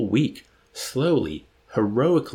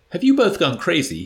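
much higher and louder, indignant; angry demand; 9 semitones higher, 6 dB louder than usual. A narrator's reading. Doctor Nika confronts his enlisted men, loud and indignant. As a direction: demand an answer, outraged. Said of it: "he demanded".

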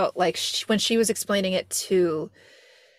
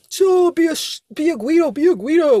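high, loud mock chant; pitched-up, hammed-up urging; egging him on; 7 semitones higher, 6 dB louder than usual. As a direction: imitate rowdy friends goading someone. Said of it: "where they're like".

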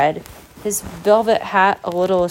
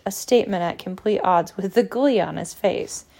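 usual speaking voice

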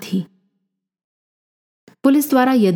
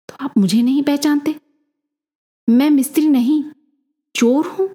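high-pitched challenge, rising at the end; changed voice; 7 semitones higher than usual. A narrator's reading. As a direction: throw it back as an incredulous question. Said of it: "Yadu की आवाज बदल गई थी".

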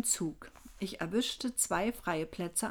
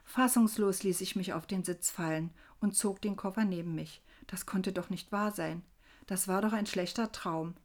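plain reading voice

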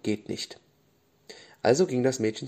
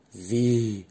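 astonished. A narrator's reading. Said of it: "fragte die Blume erstaunt".

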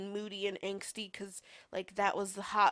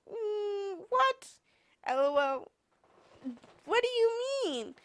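squeaky high, brushing it off with a laugh; girl brushing off a boy's goodbye text; mock oblivious disbelief; 12 semitones higher, 6 dB louder than usual. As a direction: play dumb in a much higher voice. Reacting to his goodbye text.

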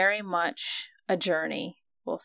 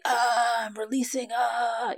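mock release cry; 5 semitones higher, slower than usual. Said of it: "screaming releases of".

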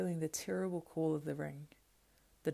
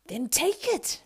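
high louder creature urging; the other voice; 9 semitones higher, 8 dB louder, slower than usual. Acted. The other self urging Schmiegel, louder and grasping. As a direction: urge it, grasping. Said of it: "said the other".

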